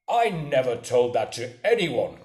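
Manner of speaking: loud, emphatic protest; indignant cry. A narrator's reading. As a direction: cry out in your own defence. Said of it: "cried the squire".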